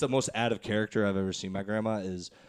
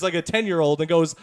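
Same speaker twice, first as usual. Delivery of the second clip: ordinary talk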